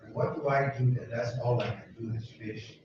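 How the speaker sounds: ordinary delivery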